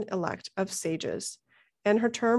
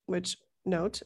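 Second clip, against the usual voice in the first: normal talking voice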